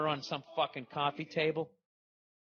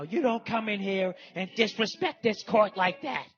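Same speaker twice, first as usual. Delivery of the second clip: imitating a judge yelling, louder and higher; mock courtroom scream; 7 semitones higher, 6 dB louder than usual. Lady judge screaming at a guy in court, mock furious yell. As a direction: hams up a judge tearing into a guy. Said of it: "some lady screaming at this guy going".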